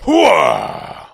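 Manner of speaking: loud army-style "Hooah"; loud gung-ho soldier. Acted. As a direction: bark it like a soldier. Pacino's Scent of a Woman catchphrase.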